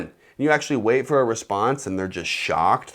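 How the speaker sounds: ordinary delivery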